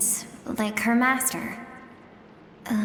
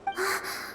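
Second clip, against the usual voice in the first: sharp high gasp; 9 semitones higher, 6 dB quieter than usual. Acted. Narrator gasping as they near the end, high gasp. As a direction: catch your breath in surprise. Sudden gasp before speaking.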